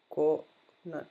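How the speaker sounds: normal talking voice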